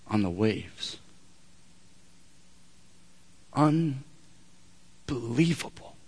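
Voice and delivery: low, soft, slowed-down storytelling; quiet wonder, with long pauses; hushed awe. Real, not acted. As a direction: drop to a hush and let each phrase hang. Hushed awe at Peter on waves.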